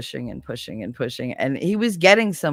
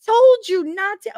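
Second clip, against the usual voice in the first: mock scolding; 5 dB louder than usual. Scolding ex-husband.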